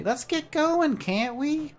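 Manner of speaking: very high, slow, squeaky sprite voice; a tiny bird-sized helper, cheerful; chirpy little creature. Acted. Jan introduces himself to the traveller, high and chirpy.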